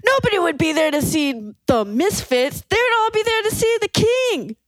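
high put-on voice for the rock star. Teller doing [name]'s voice about Misfits fans. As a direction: push the voice up, dismissive.

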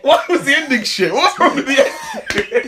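asking through laughter; high, amused, laughing challenge. Real, not acted. Teasing challenge while laughing.

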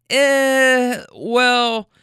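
high, drawn-out eh, well; hesitant eh well. Retold or imitated. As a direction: stall before telling the awkward part. Guy hesitates before answering.

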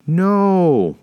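high, loud, long refusal; calling out. A narrator's reading. Mother refusing the dragon's offer, calling out loudly. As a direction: cry it out to a giant.

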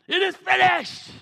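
loud, high triumphant cry; victorious shout. Shown as a demonstration. Preacher shouting Jesus's last words to the congregation in triumph. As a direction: belt it out like a victory. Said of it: "Jesus is excited".

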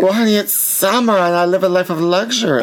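high, airy lady-of-leisure voice; lavish housewife. Acted. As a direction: play the pampered rich housewife. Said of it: "You sound like a lavish housewife".

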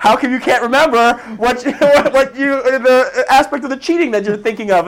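hammed-up loud, high-pitched demand; exasperated examiner. Examiner pressing a candidate about cheating, mock exasperated shouting. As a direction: imitate an interrogator yelling in disbelief. Retold examiner losing patience in interrogation.

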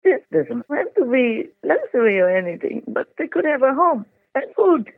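tearful grief. Real, not acted. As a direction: voice lifting with real sorrow. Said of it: "But I just cry again".

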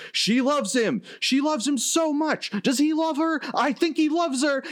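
very high, overwrought announcer cries; Gorilla Monsoon. Retold or imitated. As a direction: gush like a commentator swept up in romance. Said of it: "announcer's Gorilla Monsoon is like".

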